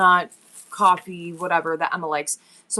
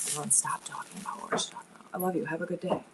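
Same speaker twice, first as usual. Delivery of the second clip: fast, soft whisper; 9 dB quieter, faster than usual. Real, not acted. Mother shushing chat about the secret cake, fast whisper. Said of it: "everyone, shh".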